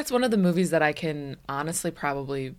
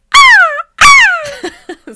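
very high, loud gull cries; shrieking mock gull call, twice; female seagull squawk; 19 dB louder than usual. Shown as a demonstration. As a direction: imitate a lady seagull with piercing squawks. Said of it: "what's your female?".

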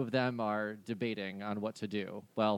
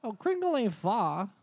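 higher, friendly reindeer voice; 8 semitones higher, 5 dB louder than usual. Acted. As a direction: play the chatty reindeer.

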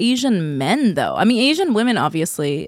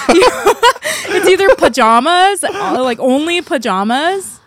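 high, laughing while talking; words bubbling with laughter; 8 semitones higher, 5 dB louder than usual. Real, not acted. Laughing through the pajama joke.

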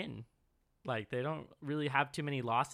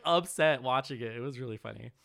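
usual speaking voice

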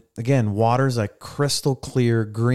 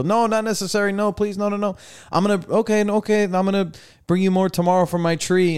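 higher, insistent mock waiter voice; waiter refusing tip; 9 semitones higher than usual. The beach waiter refusing a twenty dollar tip, in a high mock voice. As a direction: play the waiter politely pushing the money back.

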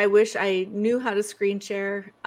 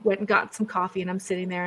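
ordinary talk

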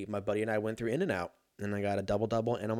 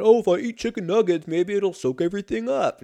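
put-on higher, louder hopeful drunk voice; drunk self-delusion; 9 semitones higher, 6 dB louder than usual. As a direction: talk yourself into it, eager and loud. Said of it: "you fake yourself out going".

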